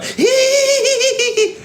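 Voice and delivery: very high, sustained mock giggle; exaggerated high-pitched fake laugh; imitated laugh. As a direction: mimic her laugh as one long squeal. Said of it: "شفتوا بس تضحك انتو؟".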